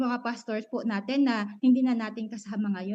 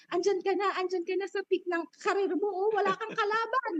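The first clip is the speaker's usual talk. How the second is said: imitating people; 7 semitones higher than usual. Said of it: "sasabihin ng mga tao".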